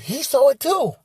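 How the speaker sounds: mock excited lady; excited accusation. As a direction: point at him and insist. Lady points, vindicated.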